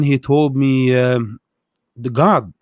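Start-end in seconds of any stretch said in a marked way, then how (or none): none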